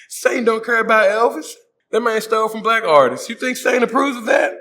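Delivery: high, loud disbelief; bro, are you serious; incredulous. Real, not acted. Incredulous rant, high pitch.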